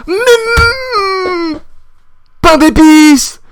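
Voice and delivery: high, slow strangled wail of frustration; cri de rage. Real, not acted. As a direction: howl the mock curse word. Rage de joueur, cri de dépit. (cri de dépit aigu et traînant)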